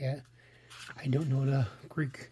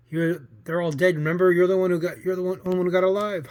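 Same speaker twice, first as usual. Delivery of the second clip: raised voice, building louder; loud self-reassurance; 4 semitones higher, 7 dB louder than usual. Acted. Costa reminding himself they're all dead, loudly. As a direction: push it out louder toward a crazed laugh.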